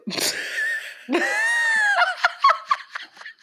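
high shrieking laugh. Real, not acted. Bursts out laughing at friend.

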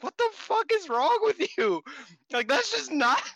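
a stunned 'what the hell' disbelief; shocked outburst. Retold or imitated. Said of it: "And I was like".

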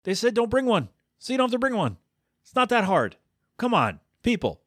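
high, pleading exasperation; exasperated. Real, not acted. Exasperated rant at guests.

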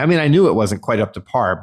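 ordinary talk

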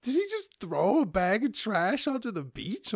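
high, loud and slow, stunned; outraged disbelief. Retold or imitated. Describing the litterer to viewers, loud outraged disbelief. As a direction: spell it out in shocked outrage. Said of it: "I'm like".

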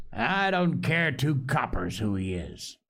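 ordinary delivery, nothing marked

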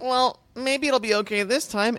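put-on high, slow child voice; tentative kid. Allergic kid about to pet dog, tentative. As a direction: venture it cautiously like a small boy.

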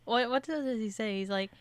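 ordinary talk, nothing marked

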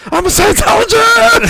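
high, slow, distorted scream; screaming into the mic. Retold or imitated. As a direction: yell it right into the microphone. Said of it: "Screaming into the microphone".

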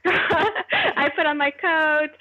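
voz aguda e lenta, rindo; laughing through words. Real, not acted. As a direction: rir enquanto fala devagar. Laughs retelling painful walk.

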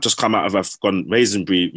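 usual speaking voice

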